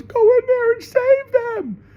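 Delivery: very high, slow falsetto plea; shrill pleading woman, loud; mock woman voice. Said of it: "The woman goes".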